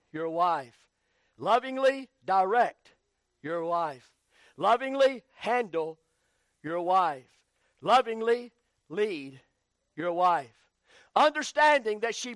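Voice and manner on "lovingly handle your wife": usual speaking voice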